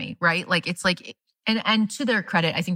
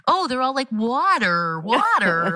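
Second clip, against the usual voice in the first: mock American accent; 4 semitones higher, slower than usual. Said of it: "they hear Americans do an accent".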